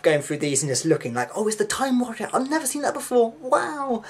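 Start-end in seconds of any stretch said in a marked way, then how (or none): 1.3-4.0 much higher, thrilled discovery; excited. Retold or imitated. Finding an unseen Doctor Who story, thrilled and much higher. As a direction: replay the child's joy at finding the tape. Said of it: "the most exciting moment of your life".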